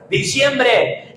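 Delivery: ordinary talk, nothing marked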